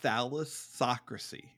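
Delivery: thalassocracy said like phallus, tha-LASS-ocracy; coaching the host to say thalassocracy; pronunciation demo. Shown as a demonstration. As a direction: rhyme the middle of thalassocracy with phallus. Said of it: "Say it just like that".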